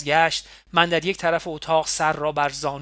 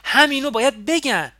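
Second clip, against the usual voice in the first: shouting, much higher and louder; raised voice; 8 semitones higher, 8 dB louder than usual. A narrator's reading. Nima shouting back at Ali in their quarrel. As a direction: shout him down in anger. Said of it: "نیما هم صدایش رو بالا برد".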